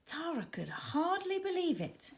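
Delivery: ordinary delivery, nothing marked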